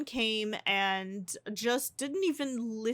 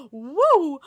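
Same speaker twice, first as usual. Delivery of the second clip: excited whoop; 6 semitones higher, 11 dB louder than usual. Real, not acted. Ending the episode.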